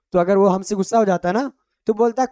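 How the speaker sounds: ordinary talk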